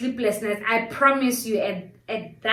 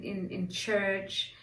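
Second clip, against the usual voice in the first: ordinary talk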